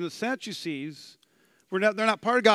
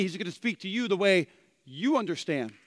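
ordinary talk

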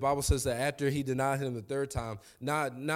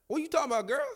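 sharp high mock retort; incredulous Peter; 12 semitones higher, much faster than usual. Preacher voicing Peter snapping at the girl. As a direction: fire it back at the girl in disbelief. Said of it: "in my translation, he's like".

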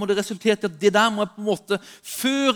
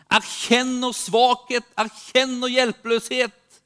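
raised, building preacher's cadence; preaching exhortation; 3 semitones higher, 3 dB louder than usual. Real, not acted. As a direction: press each phrase home, rising in pitch and force.

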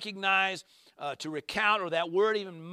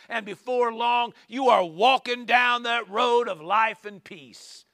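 building preacher's cry, louder on God; fervent preaching; 3 semitones higher, 4 dB louder than usual. Acted. Preacher urging the congregation toward life and peace, fervent. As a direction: preach it out with rising fire.

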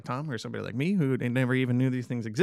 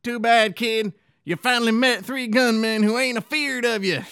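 put-on old cowboy voice; 9 semitones higher, 6 dB louder than usual. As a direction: play a grizzled Western gunman. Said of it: "Let me try".

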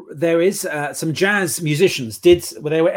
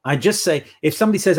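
ordinary talk, nothing marked

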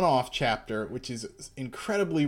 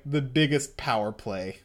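normal talking voice

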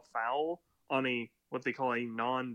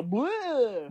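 high loud silly grunt; cartoon flop noise; mock flop grunt; 8 semitones higher, 6 dB louder than usual. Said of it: "they just go".

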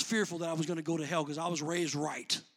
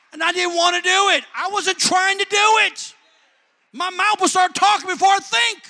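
loud, raised-pitch sermon delivery; full-force preaching; 7 semitones higher, 13 dB louder than usual. Real, not acted. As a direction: preach it at full volume, pitched high, driving the point home.

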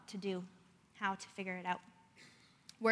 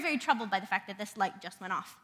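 ordinary delivery

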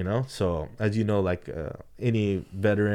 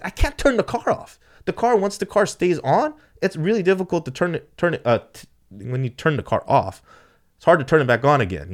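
exasperated protest; 9 semitones higher, 6 dB louder than usual. Retold or imitated. Driver refusing Cliff's order to turn car off, protesting. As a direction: plead the case as if cornered.

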